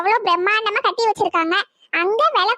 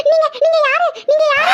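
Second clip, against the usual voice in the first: high stammered question rising to a shout; acted panicked cry; terrified; 5 semitones higher than usual. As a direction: stumble on the words in fright. Terrified, asks ghost who it is.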